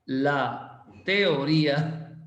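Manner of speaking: ordinary talk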